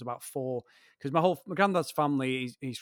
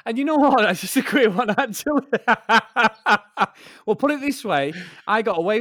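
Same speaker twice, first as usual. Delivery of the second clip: loud, high, breaking up with laughter; laughing; 7 semitones higher, 11 dB louder than usual. Real, not acted. Teller amused by own school memory, laughing as they speak. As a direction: talk through a burst of laughter.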